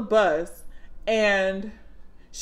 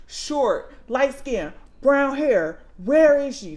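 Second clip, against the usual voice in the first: frantic description; 5 semitones higher, 6 dB louder, slower than usual. Retold or imitated. Frantic mom questions bus driver.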